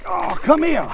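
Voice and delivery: sudden loud high cry to the fish; real burst of excitement; excited call. Real, not acted. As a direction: grab at it with the voice. Fish nearly lost.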